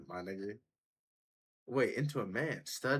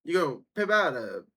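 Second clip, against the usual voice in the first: higher, louder echo of the clip's punchline; 9 semitones higher, 7 dB louder than usual. Retold or imitated. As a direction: repeat the line like the streamer in the clip, a bit higher. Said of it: "He's, he's working on the comeback".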